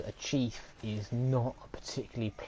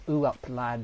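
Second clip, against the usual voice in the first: put-on northern accent; 3 semitones higher, 7 dB louder than usual. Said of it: "you were like".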